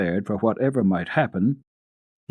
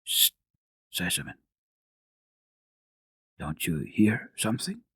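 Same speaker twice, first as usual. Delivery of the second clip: softer, hushed alert; hushed warning; 5 dB quieter than usual. A narrator's reading. Doctor hushes his friend, soft and tense. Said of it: "the doctor lifted his finger".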